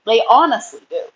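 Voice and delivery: ordinary delivery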